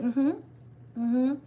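humming along patiently; fake-patient murmur of agreement; patient mm-hmm. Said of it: "try to be patient and just be like".

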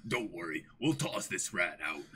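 a burly estate guard taking charge. Acted.

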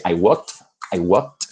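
"walked" ending in a crisp t sound, no extra vowel; clear final t. Shown as a demonstration. Teacher showing the right past tense with a final t. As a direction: clip "walked" to end on a sharp t. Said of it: "escuchen esa T".

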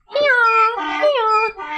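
high-pitched donkey hee-haw; donkey braying. Pretend. Storyteller playing the donkey's sound effect, braying high. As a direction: bray like a donkey in short bursts. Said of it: "on the donkey's backs".

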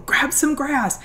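lightly voiced little daughter, matter-of-fact; bossy little girl. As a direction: instruct like a know-it-all kid. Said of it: "my little nine-year-old going".

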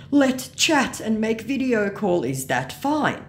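slow, loud mock scammer; mock a scammer's laboured request. Scammer asking a fan to video chat, mock eager.

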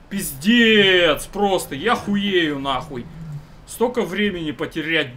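loud furious swearing rant; raging gamer. Real, not acted. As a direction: vent at the gearbox, loud and cursing. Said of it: "я начинаю бомбить".

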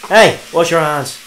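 slow, loud barked call across the room. Acted. Officer calling out to a stranger, loud and firm.

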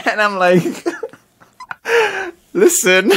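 high, loud, breaking into laughter; laughing. Real, not acted. Guest telling host about both coworkers quitting, laughing. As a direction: struggle to get it out through real laughter.

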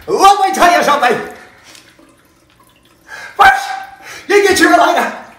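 high, loud manic safety man; hammed-up Fire Marshal Bill impression. Fire Marshal Bill gasoline bit.

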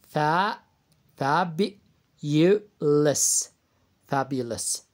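fabulous said slowly, split into parts; syllable breakdown demo. Shown as a demonstration. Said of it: "معايا كلمة". (تقطيع كلمة fabulous إلى مقاطع)

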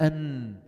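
أن ending with a held nasal n; letter exit demo. Shown as a demonstration. Teacher testing the noon exit, sounding أن. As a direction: let أن ring in the nose. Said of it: "وين انتهى الصوت".

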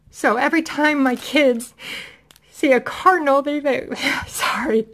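fighting back tears over her late dad; choked up. Real, not acted. Mother telling viewers about her late dad, choked up. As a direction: voice catching and rising as tears come, with a teary laugh. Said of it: "I just get very emotional".

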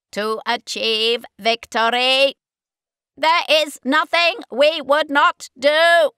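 loud, slow, harsh metallic Dalek. Acted. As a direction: grind it out like a Dalek. Dalek voice in story.

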